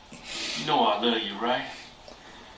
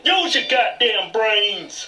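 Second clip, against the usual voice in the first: slow scolding; 4 semitones higher, much slower than usual. Acted. Scolding the helper.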